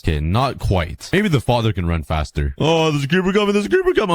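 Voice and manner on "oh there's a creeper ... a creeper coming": panicked. Real, not acted. Creeper approaching, panicked.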